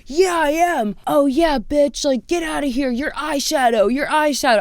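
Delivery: lightly voiced drunk guy jeering; drunk heckler.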